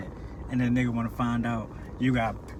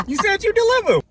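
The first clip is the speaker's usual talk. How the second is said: high, loud hammed-up complaint; mock complaint; 12 dB louder than usual. Buyer squealing at the dealer.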